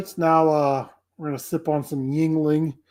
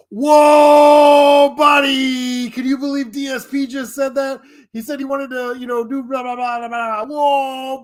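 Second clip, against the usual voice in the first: high, loud, excited mock commentator; Kino Casino host impression; 8 semitones higher, 5 dB louder than usual. Imitating the Kino Casino host reacting to DSP news in a high hyped voice. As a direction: shout it like an amazed hype host. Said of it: "That's my impression of Kino Casino".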